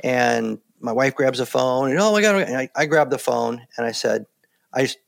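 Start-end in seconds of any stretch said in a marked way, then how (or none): 2.0-2.4 a mother's shocked burst at the news; alarmed gasp. Retold or imitated. Wife grabbing the phone about the crash, alarmed high cry.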